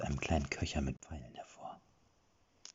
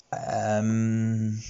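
awkward hesitation; 5 semitones higher, 5 dB louder than usual. A narrator's reading.

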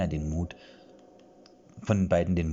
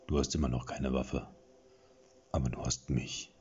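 lightly voiced proud boast; 5 semitones lower than usual. A narrator's reading. Companion offering himself as weapon, mildly proud. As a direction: puff up a little. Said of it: "sagte er mit einer stolzen Geste".

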